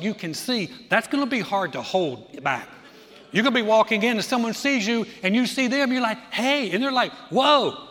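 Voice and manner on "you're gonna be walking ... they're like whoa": loud, high amazed exclamation; shouting in wonder at the healed man; excited greeting. Retold or imitated. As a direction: call out in amazed surprise. Acted excited street meeting.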